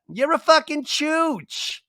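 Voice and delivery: put-on very high, slow kid voice; little girl's voice. Repeating the kid's curse for a ringtone, very high and slow. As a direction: squeak it like a toddler swearing. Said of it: "I love that".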